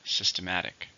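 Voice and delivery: systematic with "-mat-" stressed; teacher says systematic, leaning on "-mat-"; stress on the third syllable. Shown as a demonstration. Model the word for students, stress on "-mat-". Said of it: "stress on the third".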